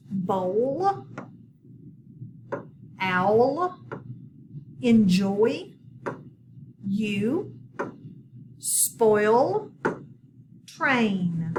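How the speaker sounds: each word alone, drawn out and clearly separated; slow word list for the class to echo; words for repeating. Shown as a demonstration. Teacher has pupils repeat spelling words, clear list delivery. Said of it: "If you will repeat after me".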